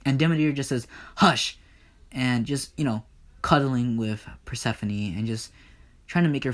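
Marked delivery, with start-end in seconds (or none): 1.2-1.6 louder, higher one-word rebuke; sharp hushing. Acted. Demeter silences Poseidon to protect Persephone, sharp hush. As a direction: cut them off abruptly.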